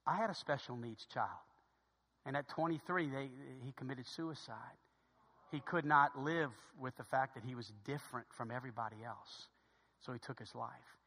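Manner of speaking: low, hushed retelling of a loss; soft, heavy pauses, sinking at the ends; grave confiding. Retold or imitated. Stranger confides his son's suicide.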